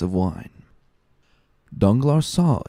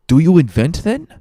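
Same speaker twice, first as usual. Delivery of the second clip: impatient; 9 semitones higher, 7 dB louder than usual. Acted. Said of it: "said Fernand impatiently".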